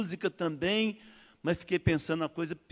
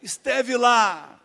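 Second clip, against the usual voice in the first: preacher's call; 7 semitones higher, 8 dB louder, much slower than usual. Retold or imitated. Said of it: "a falação dele".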